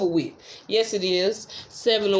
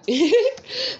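a sudden high squeal of a laugh; high burst of laughter; 10 semitones higher than usual. Real, not acted. Tarot reader cracking up while mocking the baby mother, high laugh. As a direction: break into a high laugh mid-quote.

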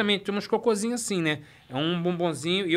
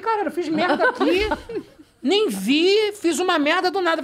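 put-on higher, louder panicked boy; panicked kid; 10 semitones higher, 5 dB louder, slower than usual. Boy realizing his mistake, higher panicked voice. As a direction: squeal it like a caught kid.

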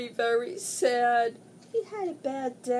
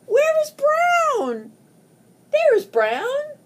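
high calling out; 10 dB louder than usual. Acted. High called search voice.

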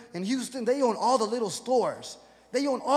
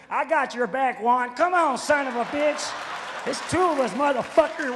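put-on higher, louder good-ol'-boy holler; 3 semitones higher, 4 dB louder than usual. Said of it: "big old redneck behind me".

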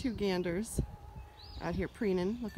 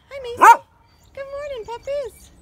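higher cooing greeting; high pet talk; 15 dB louder than usual. Real, not acted. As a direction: coo hello to the dogs. Greeting the dogs.

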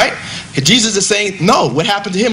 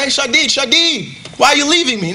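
mock plea; 7 semitones higher than usual. Speaker pretending to call after himself at the podium, high plaintive. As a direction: plead after yourself as if left behind. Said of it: "I say".

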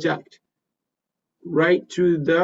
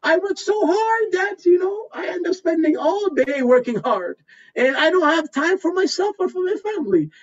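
high, whiny voice of a fake-modest job candidate; fake humble interviewee; 11 semitones higher than usual. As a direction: play the insincere candidate bragging in disguise. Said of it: "it's the worst when you come up".

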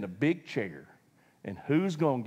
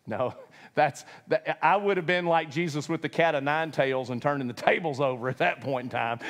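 ordinary delivery

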